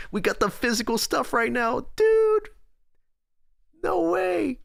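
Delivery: high-pitched thrilled astonishment; amazed excitement. Real, not acted. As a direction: voice shooting very high. Amazed viewer fetched real notebook.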